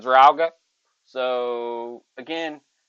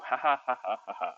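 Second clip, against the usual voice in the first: mock laugh; 6 dB quieter, faster than usual.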